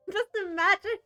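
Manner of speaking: loud laughing, rising "Just imagine"; laughing loudly. Real, not acted. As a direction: laugh through "Just" and lift the end. Laughing at the reveal.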